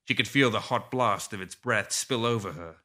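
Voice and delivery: plain reading voice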